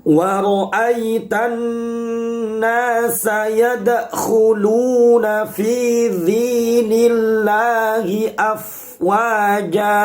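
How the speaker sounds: slow, drawn-out chanted Quran recitation. Shown as a demonstration. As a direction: recite the verse slowly, melodically. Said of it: "Saya ulangi". (tilawah Al-Quran yang lambat dan berirama)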